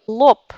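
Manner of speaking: "lop" ends in a voiceless P; final B devoiced. Shown as a demonstration. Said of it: "Par exemple".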